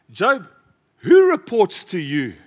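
high, loud booming divine challenge; God challenging. Acted. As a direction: call down from heaven to Job. God voiced challenging Job.

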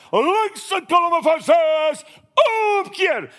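high, slow shouted Afrikaans drill command, omkeer yelled very high; RSM shouting. Retold or imitated. RSM shouting the turn order at the marching troops, full parade yell. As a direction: bellow the order across the parade ground. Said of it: "And he shouts".

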